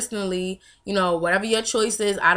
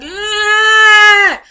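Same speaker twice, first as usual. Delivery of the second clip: long, loud, high newborn whine; high-pitched cranky baby noise; imitated baby squawk; 13 semitones higher, 14 dB louder than usual. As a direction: wail in mock baby annoyance. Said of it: "side-eyed me like".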